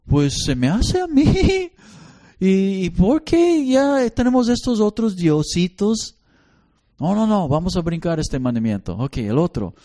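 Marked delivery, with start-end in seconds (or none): 0.1-6.1 put-on higher child voice, puzzled and giggling; a kid pointing out the family's little gods; child's voice. Child asking the parent about the other little gods, high and puzzled. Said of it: "ellos van a decir". 7.0-9.0 fast embarrassed brush-off; flustered dodge. Acted. Parent hastily skipping the commandment to avoid the child's question.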